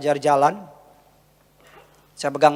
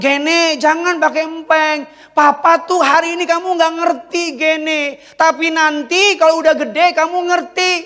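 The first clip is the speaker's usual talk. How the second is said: high-pitched parental coaxing; father pleading with toddler; 11 semitones higher, 3 dB louder than usual. Retold or imitated. Papa telling Gene not to use the pacifier, high-pitched coaxing. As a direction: explain it earnestly to a small child, pitched high.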